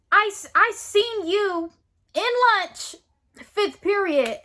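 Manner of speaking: high, loud, drawn-out teacher imitation; mock the teacher's insistent accusation; accusing teacher.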